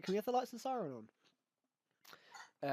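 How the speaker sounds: squeaky eager mock plea for the siren; mocking the Doctor. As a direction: parody her eager question, drawn out and high.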